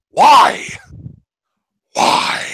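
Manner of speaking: a loud, high, evil "why?" then a voiceless, breathy "Why?". Acted. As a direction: ask "why" like a cackling villain. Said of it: "I had to break out the evil voice there".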